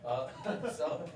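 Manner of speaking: ordinary talk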